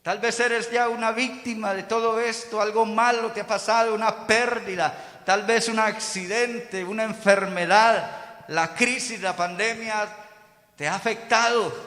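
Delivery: normal talking voice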